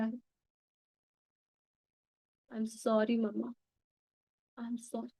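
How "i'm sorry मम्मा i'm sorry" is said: very slow, much softer sorry dying to a breath; tender apology. Shown as a demonstration. Daughter asking her mother's forgiveness, slow and hushed. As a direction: barely say it, letting it fade out.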